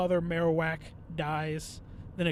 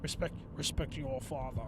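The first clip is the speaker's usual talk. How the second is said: mock stern father; 5 semitones lower, 6 dB quieter than usual. Playing stern father after "I'm your father.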